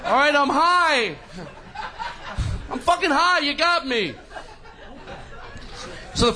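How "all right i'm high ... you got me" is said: mock confession. Cracking and confessing to waiter.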